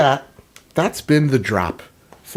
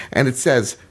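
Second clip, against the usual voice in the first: usual speaking voice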